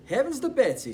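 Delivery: waitress startled by the cat on the tractor; exclaimed. Acted. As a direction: exclaim it in shock. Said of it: "she exclaimed".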